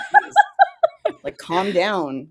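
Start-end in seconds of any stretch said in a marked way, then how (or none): 0.1-1.2 laughing. Real, not acted. Laughing dismissal.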